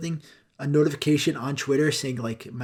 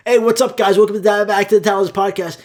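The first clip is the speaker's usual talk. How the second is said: higher, loud podcast intro shouted on a bus; pretend yelling; 5 semitones higher, 7 dB louder than usual. Host greeting his listeners on a bus, shouted loud. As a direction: bellow the greeting to the whole bus. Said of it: "just start yelling".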